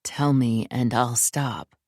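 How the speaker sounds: a hint of a low, firm man; low, firm man's warning; low male voice. A narrator's reading. Male character demands.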